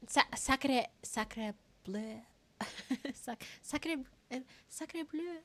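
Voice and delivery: soft, halting French accent; French accent attempt. Shown as a demonstration. Streamer testing French accents, tentative French accent. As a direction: fumble toward a French accent. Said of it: "How do French accents work?".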